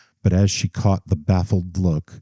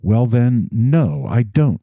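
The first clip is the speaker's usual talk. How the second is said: raised, defiant answer; defiant retort; 5 semitones higher, 4 dB louder, slower than usual. Acted. Daughter defying her father about children, defiant and raised. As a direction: throw the no back at him. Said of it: "fixed defiantly on his own".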